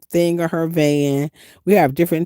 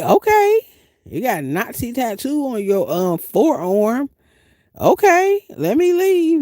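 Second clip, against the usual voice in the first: mock compliance; 7 semitones higher than usual. Mock reply to casino bouncer.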